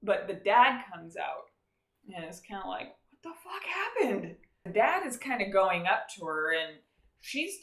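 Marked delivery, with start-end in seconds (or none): 3.1-4.4 baffled dad. Retold or imitated. Dad sees destroyed barn.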